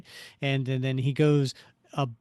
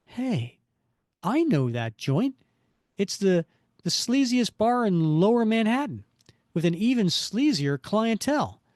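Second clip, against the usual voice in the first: higher-pitched, puzzled superhero thinking aloud; lively hero monologue, pitched up; Spider-Man; 7 semitones higher, 4 dB louder than usual. Acted. Hero spots a familiar sleazy bar, puzzled then knowing.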